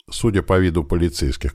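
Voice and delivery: plain reading voice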